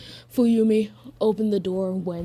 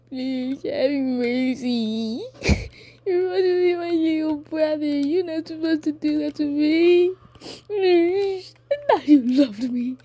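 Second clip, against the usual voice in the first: high, hammed-up tearful pleading; mock crying; 7 semitones higher, 3 dB louder than usual. Toya begs his little brother for mercy, mock crying. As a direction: wail it like a wounded, whining brother. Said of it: "freaking crying".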